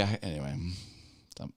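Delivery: ordinary talk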